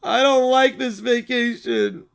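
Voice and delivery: slow, drawn-out mock whine; whining child. Child hating the vacation, slow whiny misery. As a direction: stretch each word into a sulk.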